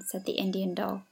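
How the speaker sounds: plain reading voice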